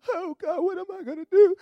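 high, loud mock panic; hammed-up scared cry, pitched high. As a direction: play up a frightened wail. Mimics scared person panicking.

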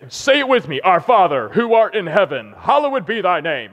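a locker-room leader chanting the prayer aloud; captain reciting. Retold or imitated. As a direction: lead the team through the words. Captain leads team reciting prayer.

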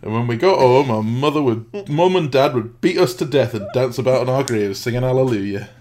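loud mock Yorkshire, laughing through it; hammed-up Yorkshireman tall tale; Yorkshire old man. Finishing the Monty Python sketch in Yorkshire accent. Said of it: "Four Yorkshiremen skit from Monty Python".

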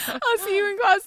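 high, loud mock-teacher goodbye; teacher imitation. Teacher parting from a student in public, hammed-up. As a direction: play up a teacher's sign-off. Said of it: "people pretending they're teachers".

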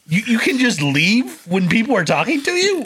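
high-pitched incredulous question; baffled, voice jumping up high; genuine surprise, retold. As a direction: act out being taken aback that this is allowed. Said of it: "I was genuinely surprised".

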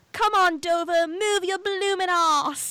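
high, loud racegoer's yell, last words drawn out; screaming cheer. Retold or imitated. Imitating Eliza caught up at the horse race, screaming. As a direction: holler it like urging a horse on. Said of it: "gets up and screams".

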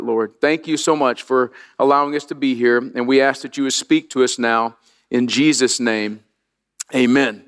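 low, hushed pastor's prayer. Real, not acted. Pastor praying for miracles on Christmas Eve, hushed prayer voice. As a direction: pray gently over the congregation.

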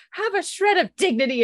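high-pitched indignant rebuke; mock scolding. Woman shaming Alicia in the street, mock scolding. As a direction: scold her in hammed-up outrage.

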